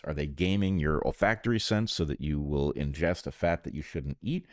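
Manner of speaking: ordinary talk, nothing marked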